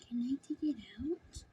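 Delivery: whispering. Real, not acted. Quiet aside while handling toy.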